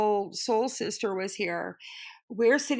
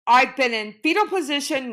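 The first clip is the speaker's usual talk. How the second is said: ordinary delivery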